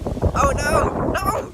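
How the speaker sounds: sudden high alarmed exclamation; startled cry. Real, not acted. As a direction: yelp as the gust hits. Gust hits, she exclaims.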